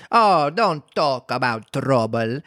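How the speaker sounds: slow, self-satisfied character voice; pleased Duchess. A narrator's reading. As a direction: wave it away, drawn out.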